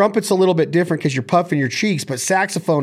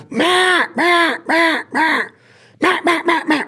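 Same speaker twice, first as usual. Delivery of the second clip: much higher, duck-like mah sounds pushed from the belly; hen mallard call; 13 semitones higher, slower than usual. Shown as a demonstration. Coach demonstrating a mallard call without a call, high duck voice. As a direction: call like a hen mallard, hot pressurized air. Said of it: "sucking it in to where my belly button".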